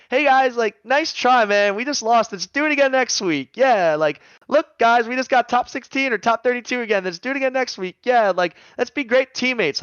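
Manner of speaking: high, loud sing-along cheerleader positivity; mock chipper. Player mocking forced positivity after a loss, all chipper. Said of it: "being all chipper".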